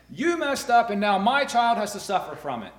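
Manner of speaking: put-on father yelling that his child suffers for another's mistake; angry father. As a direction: blame them like a wronged, angry father. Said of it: "an angry father saying".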